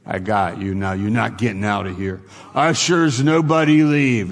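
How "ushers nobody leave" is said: joking call to the ushers, louder and higher; playful pretend order to lock the doors; mock command. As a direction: bark it out in jest, then drop it. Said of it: "No, I'm kidding".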